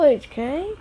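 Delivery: ordinary talk, nothing marked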